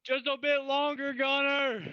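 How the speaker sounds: high, drawn-out cry to a comrade; strained heroic call. Acted. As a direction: call out high, holding on desperately. Player calls out in character.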